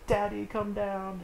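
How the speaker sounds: high-pitched imitation of a pleading son; son calling father. Son calls to his floating father, high mock plea. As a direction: call up to Daddy in a put-on high voice. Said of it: "Mister Dawes Junior is like".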